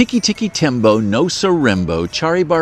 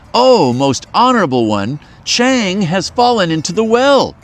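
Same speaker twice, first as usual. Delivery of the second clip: higher, louder urgent cry; raised voice cry; 7 semitones higher, 3 dB louder than usual. A narrator's reading. Boy cries to his mother at the well, raised voice. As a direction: call out to mother, pleading. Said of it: "raised his voice and cried".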